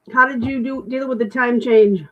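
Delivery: ordinary talk, nothing marked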